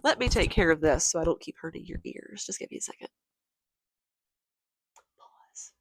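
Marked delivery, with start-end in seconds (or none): 1.4-5.7 voiceless, much softer whisper; whispered aside. Real, not acted. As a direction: hush it like a note to yourself. Pausing the recording.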